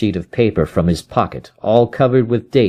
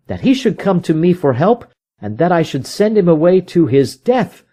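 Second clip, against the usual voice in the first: higher detective voice, stung and vowing; a proud man swearing revenge; 6 semitones higher than usual. Acted. Holmes voiced by narrator.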